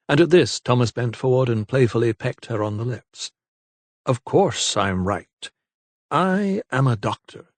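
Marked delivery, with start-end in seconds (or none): 4.1-7.5 slow, playful smugness; teasing. A narrator's reading. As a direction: drag it out to tease her. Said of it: "he teased her".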